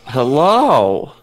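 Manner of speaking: booming loud hello; way too loud on the mic. Real, not acted. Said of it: "Wow, you are very, very loud".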